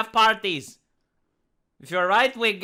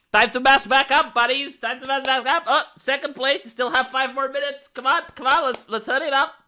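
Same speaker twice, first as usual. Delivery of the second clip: higher-pitched call to hurry along; official calling time; 4 semitones higher than usual. Acted. Supervisor calling children back to masks, higher hurrying voice. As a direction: chivvy them along in a higher voice.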